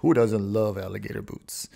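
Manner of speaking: laughing at own pompous line. Real, not acted. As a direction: chuckle through the question. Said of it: "I said that like a real pompous asshole".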